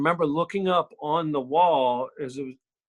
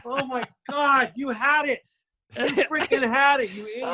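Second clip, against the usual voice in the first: Jenna; 10 semitones higher than usual. Retold or imitated. Wife berating the actor for losing the job, imitated exasperation. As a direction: scold in a hammed-up high voice. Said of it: "see Jenna going".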